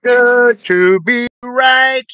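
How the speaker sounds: loud high shout into the mic. Real, not acted. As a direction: belt it out at full volume. Shouting at stream start.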